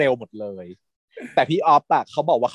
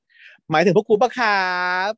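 higher, drawn-out last word; cheeky interjection; 6 semitones higher, slower than usual. Retold or imitated. Friend arriving with his gang, cheeky interjection. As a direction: stretch it out as a cocky retold entrance.

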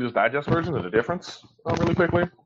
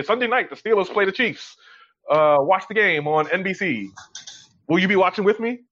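mock announcer; 6 semitones higher than usual. Mocking generic promo tweets.

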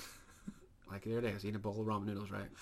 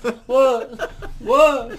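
rising frantic yelps, louder each time; panicked mock shouts; 13 semitones higher, 22 dB louder, much slower than usual. Hosts acting out a jumpy man, frantic yelling. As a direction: jump at every sound, shouting.

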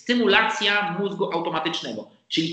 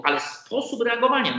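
ordinary delivery

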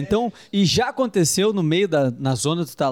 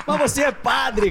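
laughing; 8 semitones higher, slower than usual. Real, not acted. Laughing disbelief at the priest.

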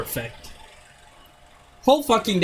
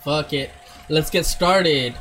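ordinary talk, nothing marked

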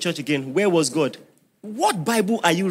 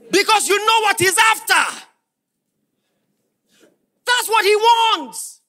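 much higher, louder, crying out; full-force preaching; preacher shout; 13 semitones higher, 6 dB louder than usual. Real, not acted. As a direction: hit the top of the sermon. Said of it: "preaching peak".

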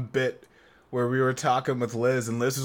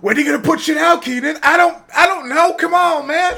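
high, loud, fast nagging wife voice; mock Liz calling him out; imitating Liz; 9 dB louder than usual. Imitating Liz scolding Keenan.

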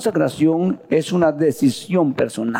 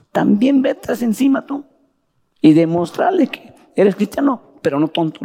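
higher, insistent scold; scolding pastor; 6 semitones higher than usual. Retold or imitated. Pastor telling the new convert not to be a fool, high and insistent. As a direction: tell him off, don't be a fool.

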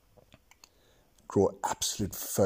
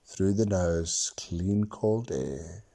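unhurried meditation guide; guided meditation. Acted. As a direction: talk them through each breath. Continues guided breathing.

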